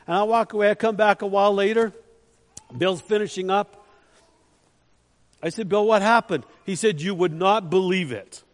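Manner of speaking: loud, driving preacher's storytelling; preaching at full force. Acted. Preacher telling youth of the answered prayer, loud and driving. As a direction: build it to a loud pulpit climax.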